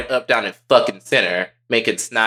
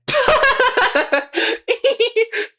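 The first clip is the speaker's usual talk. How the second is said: very high rapid giggling cackle; high laugh. Real, not acted. Laughing at their own slip, high squealing giggle.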